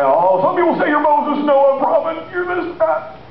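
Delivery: high-pitched listing of rumours; high, run-on answers from the crowd of disciples; disciples' chatter. Acted. Disciples rattle off rumours to Jesus.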